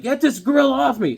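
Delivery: high, loud mock scream; cartoon worm shrieking for help. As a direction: squeal it like a panicked little worm. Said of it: "screaming like".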